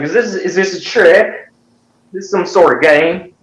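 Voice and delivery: high-pitched, baffled questioning; hammed-up, squeaky disbelief; mock suspicion. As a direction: act out fearing you are being tricked. Acts out suspicion of a trick.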